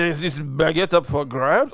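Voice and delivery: hammed-up French voice asking; playful fake French accent; mock French accent. Voicing French people finding baguette.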